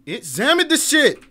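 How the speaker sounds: high, loud exasperated command; frustrated shout. Real, not acted. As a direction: bark it at the game in frustration. Frustrated clicking examine.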